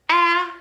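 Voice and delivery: loud, high "ah" as in dad; short A sound. Shown as a demonstration. Teacher modelling the middle A, emphatic short "ah". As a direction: isolate "ah" with the short A vowel of dad. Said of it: "like the short A sound".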